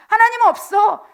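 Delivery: high, loud bitter outburst; angry complaint. Acted. Backslider shouting resentment at God, angry complaint. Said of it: "다시 분노하고 이스라엘 백성처럼 원망하고".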